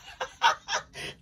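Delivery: high, breathy cackling laugh; burst of laughter. Real, not acted. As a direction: break into a high fit of laughter. Laughing outburst, breaks off from reading.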